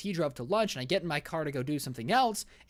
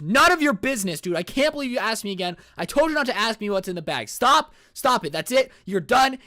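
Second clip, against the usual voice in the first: furious yelling, much louder and higher; angry shout; 5 semitones higher, 7 dB louder than usual. Retold or imitated. Passenger yelling at the driver to stop asking. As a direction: blow up at him, shouting.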